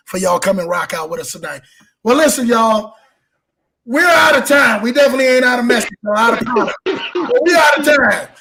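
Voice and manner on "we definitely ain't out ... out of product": rushed. Real, not acted. Rushed sign-off aside.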